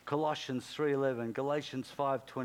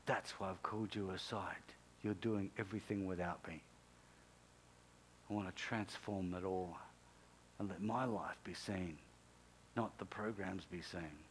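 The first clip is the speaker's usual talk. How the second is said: low, soft voice of God; God speaking quietly; 6 semitones lower, 9 dB quieter than usual. Retold or imitated. God telling Johnny why he called him aside, hushed low voice. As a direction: drop low and soft, measured pauses between phrases.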